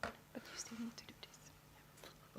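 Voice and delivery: slow, soft whisper to a colleague; whispered aside. Real, not acted. Colleague whispering a reminder to the presenter, slow whisper. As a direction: lean in and whisper it off-mic.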